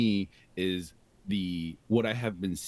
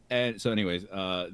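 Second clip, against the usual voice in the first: usual speaking voice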